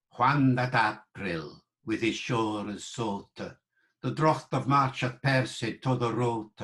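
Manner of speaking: slow, measured Middle English pronunciation; Chaucer's accent. Shown as a demonstration. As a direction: recite slowly in reconstructed Middle English, every vowel pure. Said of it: "Chaucer's accent".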